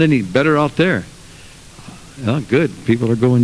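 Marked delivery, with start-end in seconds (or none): none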